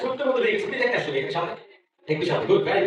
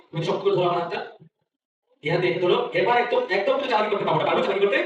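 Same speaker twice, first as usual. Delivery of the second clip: put-on kids party magician. Magician leading child on stage.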